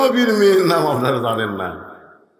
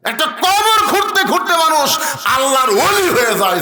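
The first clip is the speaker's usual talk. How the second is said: very high, impassioned exclamation; full-force preaching; 13 semitones higher, 5 dB louder than usual. Real, not acted. Preacher contrasting pious gravediggers with these ones, high exclaiming. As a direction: cry it out to the whole crowd.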